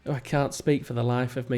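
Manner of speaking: ordinary talk, nothing marked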